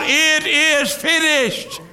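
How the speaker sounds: high, slow, ringing proclamation; triumphant cry. A narrator's reading. Angels in glory crying out, high and slow. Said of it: "and they cry out".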